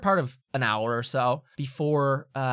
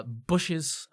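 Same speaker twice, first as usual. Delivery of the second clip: normal talking voice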